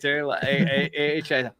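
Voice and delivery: normal talking voice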